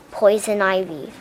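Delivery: plain reading voice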